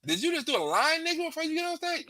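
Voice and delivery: high, fast disbelieving question; can't believe what he just saw; mock shocked comic; incredulous.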